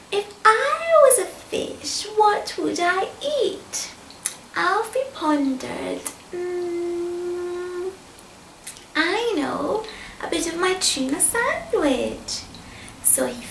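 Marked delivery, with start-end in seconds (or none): 0.1-3.9 put-on higher, louder wondering boy voice; a small boy puzzling it out, pitched up; curious boy. A narrator's reading. Alfie wondering aloud. 6.3-12.4 put-on lightly higher boy with a bright idea; a hint of a boy's sudden idea; pleased idea. A narrator's reading. Alfie has an idea.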